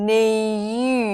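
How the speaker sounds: "new" stretched slowly as n-yoooo; drawn-out y glide. Shown as a demonstration. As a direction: pull "new" apart to show the y before oo. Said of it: "new แบบนี้". (ลากเสียง "new" ช้าๆ เป็น น-ยู)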